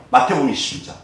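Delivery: usual speaking voice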